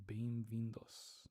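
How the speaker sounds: Bem-vindos whispered slowly, final s clear; stressed plural ending. Shown as a demonstration. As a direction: breathe Bem-vindos, marking the s. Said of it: "Bem-vindo must agree".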